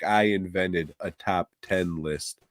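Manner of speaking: usual speaking voice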